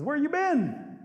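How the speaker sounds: high-pitched acted complaint; indignant demand. Possum confronting Rabbit on the road, indignant. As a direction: call out accusingly, voice shooting up.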